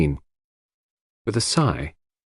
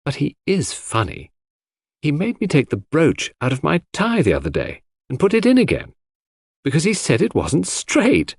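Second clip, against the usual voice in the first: put-on higher, lively voice for a friendly young woman; fond, amused Cynthia; 6 semitones higher than usual. Cynthia chatting about a dear little man, fond and amused. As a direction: play her chatting with lifted pitch.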